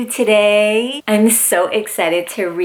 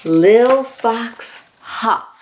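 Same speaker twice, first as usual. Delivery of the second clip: storybook reading; 3 semitones lower, slower than usual. Acted. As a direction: read it gently like bedtime to a toddler. Reads picture book aloud.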